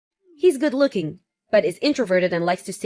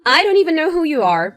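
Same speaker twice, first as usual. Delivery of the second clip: higher, louder indignant outburst; affronted, raised voice; 6 semitones higher, 5 dB louder than usual. Acted. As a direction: snap back at a meddling stranger. Acted quarrel, mother confronts meddler.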